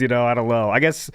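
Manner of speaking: ordinary delivery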